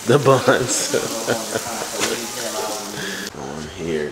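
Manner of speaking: usual speaking voice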